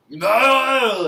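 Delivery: long, high roaring scream; Schwarzenegger scream. Retold or imitated. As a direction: imitate an action hero's anguished bellow. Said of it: "a Schwarzenegger scream".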